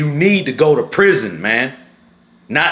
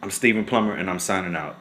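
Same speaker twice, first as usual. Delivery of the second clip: much softer, lower, drained; emotional sign-off; 8 semitones lower, 11 dB quieter than usual. Real, not acted. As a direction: sign off still shaken and quiet. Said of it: "I'm getting too emotional".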